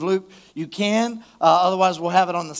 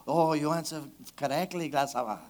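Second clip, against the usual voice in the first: put-on playful Spanish accent, a bit lower and softer; mock Spanish accent; 4 semitones lower, 9 dB quieter than usual. Pastor reading Jesus to the congregation in a mock Spanish accent. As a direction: toss off "gracias" in a jokey Spanish accent. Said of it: "don't you want to say it with an accent".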